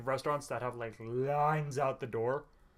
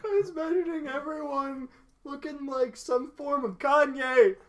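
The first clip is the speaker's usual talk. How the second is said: high, loud, gleeful build; high, loud giddy listing; excited riffing; 9 semitones higher, 10 dB louder, slower than usual. Real, not acted. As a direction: paint the silly picture with glee. Imagining [name]-dressed waiters.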